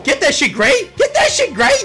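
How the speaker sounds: high, very loud cheering; hype shout. Real, not acted. Streamer cheering on a teammate, hype shout. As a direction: holler encouragement at full volume.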